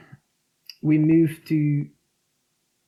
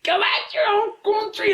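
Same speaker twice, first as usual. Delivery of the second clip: much higher, jeering "Go back your own country"; mocking hostile locals; 4 dB louder than usual. Brazilian gym owner mimicking locals who smashed windows, jeering. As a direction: sneer it like an angry neighbour.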